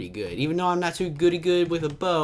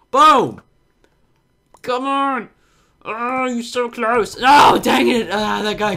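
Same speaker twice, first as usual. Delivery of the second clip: high, loud gamer yells; loud frustrated cheering, high; excited shouting; 10 semitones higher, 10 dB louder, slower than usual. Real, not acted. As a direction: shout at the screen as the shot misses. Close call while fighting in game.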